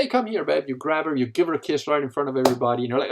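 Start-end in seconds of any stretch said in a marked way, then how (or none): 0.0-0.6 playful call-over. Acted. Husband calling wife over at party.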